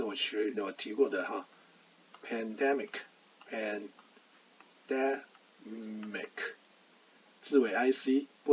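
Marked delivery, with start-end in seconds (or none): 2.2-6.3 very slow "pandemic", syllable by syllable, said twice; slow model word. Shown as a demonstration. Teacher sounding out "pandemic" slowly for learners. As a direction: draw out "pandemic" twice, one syllable at a time. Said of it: "我们举一个例子哈".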